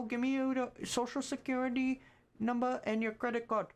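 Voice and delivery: very high mock Indian scammer accent; hammed-up Indian accent, demanding. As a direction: play a pushy Indian scam caller. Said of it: "they talk like this".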